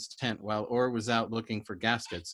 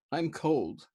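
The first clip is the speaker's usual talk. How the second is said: whimpering; 5 semitones higher than usual. Acted. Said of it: "whimpered feebly".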